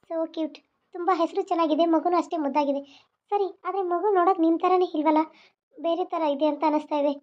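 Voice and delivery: normal talking voice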